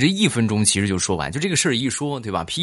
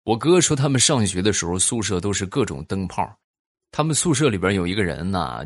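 ordinary talk, nothing marked

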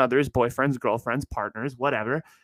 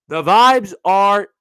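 mock gloom; 6 semitones higher, 9 dB louder than usual. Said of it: "No, I'm kidding".

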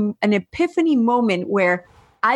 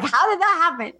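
mocking his puzzlement; 8 semitones higher than usual. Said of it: "You're still like".

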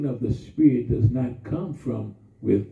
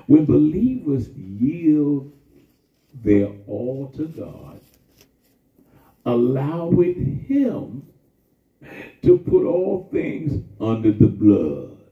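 slow preaching build; 4 dB louder, slower than usual. Real, not acted. Preaching climax, slow and loud.

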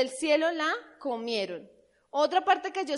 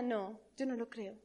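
ordinary talk

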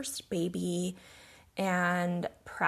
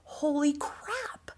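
slow breathless whisper of amazement; hushed, slow holy crap; awed whisper; 7 semitones higher, 4 dB quieter, slower than usual. Retold or imitated. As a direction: whisper it in stunned awe. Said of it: "I was like".

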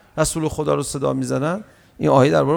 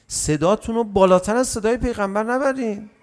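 usual speaking voice

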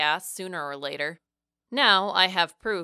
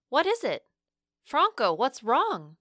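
higher, swooping confused questions; mildly panicked, pitch jumping up on the questions; confused, a bit panicked; 5 semitones higher than usual. A narrator's reading. Narrator asks Franco what's wrong, confused and a bit panicked. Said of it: "confused, perhaps even a bit panicked".

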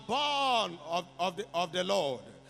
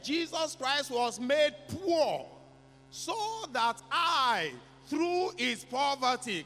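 rising, slowed preaching climax; 4 semitones higher than usual. Acted. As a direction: lift the church higher line by line. Preacher builds to declaration.

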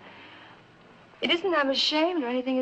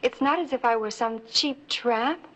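ordinary talk, nothing marked

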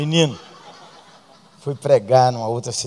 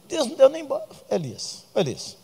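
normal talking voice

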